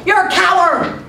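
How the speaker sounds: high-pitched acted insult; aggravated yell. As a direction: holler it like an annoyed boy. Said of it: "got aggravated and yelled".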